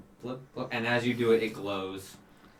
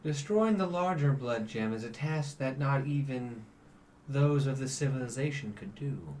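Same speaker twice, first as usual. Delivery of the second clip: measured, grave and patient; celestial angel; 2 semitones higher than usual. Acted. GM voices the celestial.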